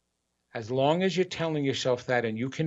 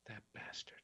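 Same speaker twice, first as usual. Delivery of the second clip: hushed, bitter under the breath; muttered curse; 4 semitones lower, 25 dB quieter than usual. Retold or imitated. Speaker voicing a resentful inner voice, hushed and bitter. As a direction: spit it out under your breath. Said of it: "If you hear this voice instead".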